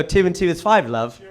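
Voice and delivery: casual British accent with a familiar tone; mock British accent. Shown as a demonstration. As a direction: say it as a matey British reassurance. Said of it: "everything sounds right in that accent".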